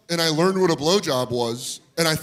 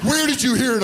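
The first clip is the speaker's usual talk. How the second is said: demanding dad; 10 semitones higher, 4 dB louder than usual. Retold or imitated. Retold dad's interrogation.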